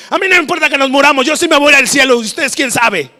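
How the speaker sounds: high, fast, loud retort; hammed-up taunting shout; dismissive comeback. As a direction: fire back with mock indifference. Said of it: "le remataría con algo así".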